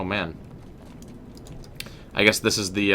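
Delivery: ordinary delivery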